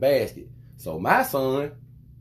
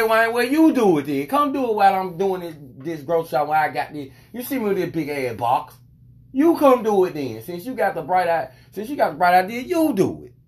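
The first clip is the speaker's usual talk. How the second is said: raised, higher irritated retort; heated comeback; 5 semitones higher, 4 dB louder than usual. Retold or imitated. Retelling how he told the lady to do it herself. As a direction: snap back at her, fed up. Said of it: "I said".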